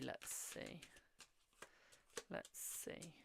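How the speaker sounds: breathy whisper under her breath; whispered muttering. Real, not acted. Tarot reader muttering to herself over the cards, whispered. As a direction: mutter it to yourself while drawing.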